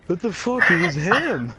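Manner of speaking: high, loud retold outcry; indignant protest. Protesting to girl after her punch, high loud indignation. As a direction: yelp in mock indignation.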